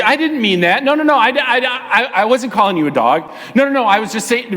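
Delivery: high, stammering protest; flustered backpedalling. Acted. Man misunderstood by a woman, flustered high-pitched backpedalling. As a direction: hurry to take it back, tripping over words.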